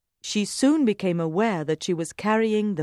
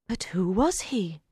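wondering whisper; 4 dB quieter than usual. A narrator's reading. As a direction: breathe it out, puzzled. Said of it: "she wondered".

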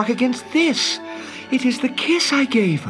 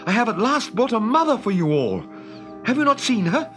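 high-pitched proud announcement; eager cry; 10 semitones higher than usual. Acted. Peter tells the boys of their new mother, excited. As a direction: keep it high and full of excitement. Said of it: "he cried".

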